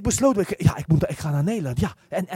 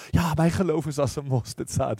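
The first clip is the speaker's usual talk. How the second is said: mock humble; 5 semitones lower than usual. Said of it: "Dat klinkt heel nederig".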